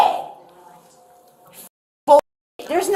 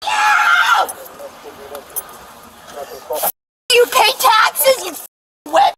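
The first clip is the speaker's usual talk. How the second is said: high, slow enraged yelling; screaming; 8 semitones higher than usual. Real, not acted. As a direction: scream abuse at the officers. Screaming slurs at officers.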